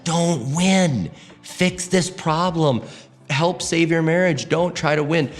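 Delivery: a hint of God speaking firmly to him. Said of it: "And God was like".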